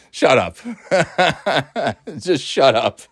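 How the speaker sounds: real laughter breaking around the words; amused, laughing scorn; laughing dismissal. Real, not acted. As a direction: brush off a silly objection while laughing. Laughs off the mocked objection.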